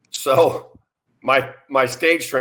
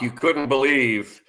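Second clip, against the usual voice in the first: usual speaking voice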